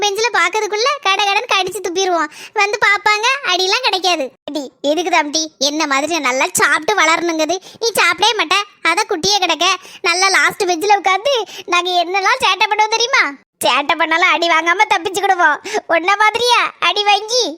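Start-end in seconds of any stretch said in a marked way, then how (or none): none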